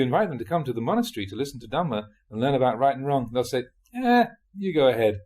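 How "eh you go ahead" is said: higher, louder dismissive "eh"; a touch of grumbling refusal; mild brush-off. Acted. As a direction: lightly wave the invitation away. Old folks declining monastery invitation.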